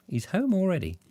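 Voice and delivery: high, sing-out mock answer; lightly imitated surprised announcement; playful reply. Playful answer to kids' question.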